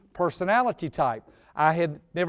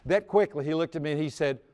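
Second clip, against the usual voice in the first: normal talking voice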